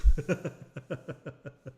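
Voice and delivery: loud burst of real laughter; hearty laugh. Real, not acted. Laughs at being called old.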